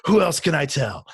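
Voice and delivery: retold excitement. Freaking out with a coworker, eager and excited. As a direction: act out bursting to share the news. Acts out eagerness to spread news.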